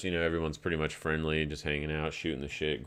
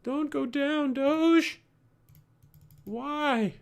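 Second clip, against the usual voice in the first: high squeaky pleading voice, much higher; mock whiny plea; 3 dB louder, much slower than usual. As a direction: beg the chart like a cartoon. Pleading with falling coin chart.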